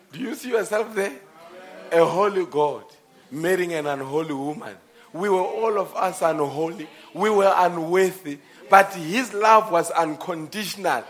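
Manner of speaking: high, loud sermon building to a shout; impassioned pulpit cry; full-force preaching. Real, not acted.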